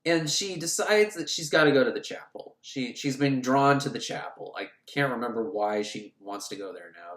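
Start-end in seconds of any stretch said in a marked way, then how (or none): none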